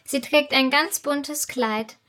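usual speaking voice

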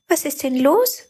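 little light asking with care; worried. A narrator's reading. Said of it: "fragte das kleine Licht besorgt". (besorgt, höher)

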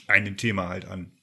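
choking on a sip mid-sentence; swallowed wrong way. Real, not acted. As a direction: push the words through a swallow gone wrong. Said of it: "Oh Gott, ich hab mich verschluckt".

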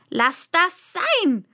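high, loud, drawn-out scolding; ranting queen. A narrator's reading. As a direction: rant at them, slow and high. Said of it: "eiferte die Königin".

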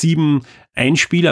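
usual speaking voice

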